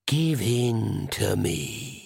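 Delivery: shadow voice. Acted. Shadow voice tempts again.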